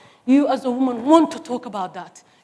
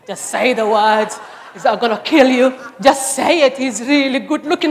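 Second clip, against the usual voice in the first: playful urging; 9 dB louder than usual. Retold or imitated. Mother urging daughter, playful.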